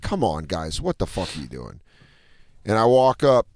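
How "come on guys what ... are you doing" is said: low, very soft grumble; irritated under the breath; quietly fed-up complaint; understated irritation. Retold or imitated. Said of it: "I'm irritated".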